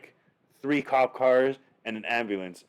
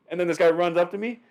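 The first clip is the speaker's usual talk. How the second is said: ordinary talk, nothing marked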